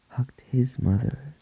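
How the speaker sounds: ordinary delivery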